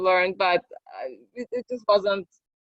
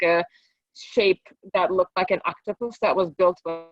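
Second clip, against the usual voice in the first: usual speaking voice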